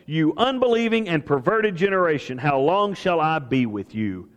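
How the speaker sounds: ordinary talk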